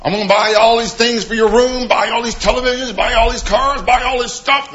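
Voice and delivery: mock a parent bragging about all they buy; half-hearted parody of a parent showing off gifts; boasting parent. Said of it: "parents make is they think".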